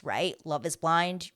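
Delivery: normal talking voice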